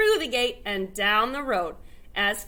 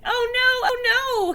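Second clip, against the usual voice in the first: mock cry; 11 semitones higher, 5 dB louder than usual. A narrator's reading. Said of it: "he cried".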